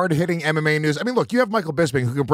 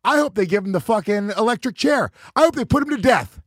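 mock outrage; 6 semitones higher than usual.

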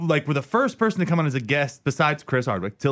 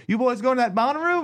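mock cop question; 9 semitones higher than usual. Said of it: "she's like".

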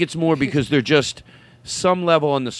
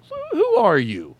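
higher, drawn-out astonished question; acted double-take; mock bewilderment; 6 semitones higher, slower than usual. Host retelling reply to young listener, mock bewilderment.

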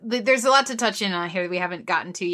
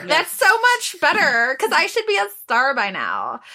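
character voice; 7 semitones higher, 5 dB louder than usual. Retold or imitated. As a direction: stretch it out like a vain star. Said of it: "that character has".

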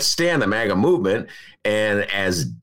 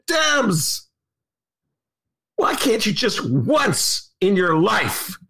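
exasperated shout; 8 semitones higher, much slower than usual. Real, not acted. Exasperated outburst at Democrats.

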